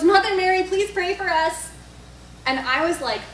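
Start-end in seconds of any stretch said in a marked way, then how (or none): 0.0-1.7 loud, high mock plea to heaven; imitating friend's plea. Friend's plea retold while lost.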